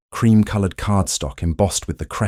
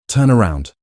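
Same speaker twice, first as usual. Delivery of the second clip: a curt, higher, louder order; commanding; 4 semitones higher, 5 dB louder than usual. Acted. Duke ordering his wife before fastening the necklace, commanding. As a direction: give the order with no room for refusal. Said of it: "he commanded".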